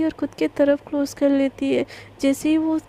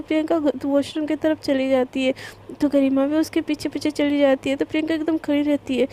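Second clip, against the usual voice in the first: ordinary delivery, nothing marked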